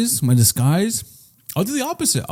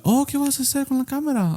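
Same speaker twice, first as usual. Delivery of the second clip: much higher, softer questioning mom; lightly voiced mom, pitched up; mom's voice; 11 semitones higher, 5 dB quieter than usual. Mom asking son about the camera, lightly voiced high mom. Voicing his mom in a retold story.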